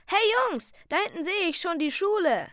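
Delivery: high, loud excited call; girl calling out excitedly. A narrator's reading. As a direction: shout to the boys to look ahead. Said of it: "rief Ruby".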